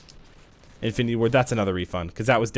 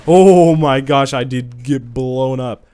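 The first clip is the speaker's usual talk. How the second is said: shocked outcry; 9 dB louder, much slower than usual. Real, not acted. Sudden death in game.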